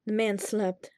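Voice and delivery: ordinary delivery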